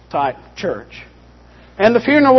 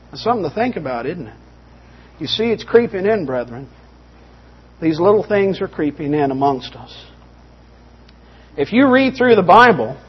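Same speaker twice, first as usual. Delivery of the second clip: usual speaking voice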